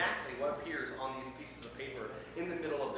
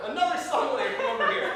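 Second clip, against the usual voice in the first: laughing; 8 semitones higher, 7 dB louder than usual. Real, not acted. Host laughing mid-request.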